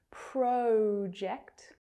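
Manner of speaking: drawn-out oh in the first syllable of Project; Australian long O. Shown as a demonstration. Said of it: "It's very common in Australia".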